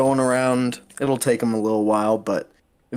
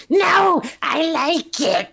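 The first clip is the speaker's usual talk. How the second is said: excited imp voice; 3 dB louder, slower than usual. Acted. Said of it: "and he goes".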